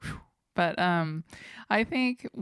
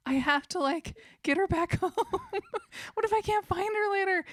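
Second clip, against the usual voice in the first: anxious inner voice; 13 semitones higher than usual. Retold or imitated. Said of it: "It's like".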